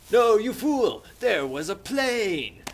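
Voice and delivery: high, loud hammed-up scolding; indignant mock yell. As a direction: berate him with theatrical outrage. Ralph scolding Jack, acted.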